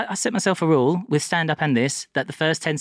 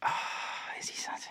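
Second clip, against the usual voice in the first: whisper; 14 dB quieter, slower than usual. Acted. Whispered teasing answer.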